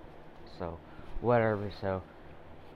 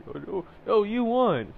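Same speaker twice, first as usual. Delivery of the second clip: high, loud mock comfort; hammed-up fake reassurance; mocking sing-song; 10 semitones higher, 7 dB louder than usual. Mocking consoling parent.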